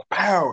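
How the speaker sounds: sharp, loud, high "pow!" for a slap; pow sound effect. Retold or imitated. Retelling a schoolyard smack, loud mock impact sound. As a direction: punch out the slap noise.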